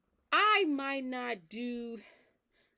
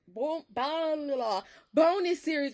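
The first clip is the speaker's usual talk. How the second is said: playful mock babble; 7 semitones higher, 7 dB louder than usual. Real, not acted. Mocking her own repeated phrase.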